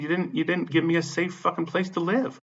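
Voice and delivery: high-pitched aggrieved complaint; retold angry accusation. Tenant blaming manager over safe housing, indignant.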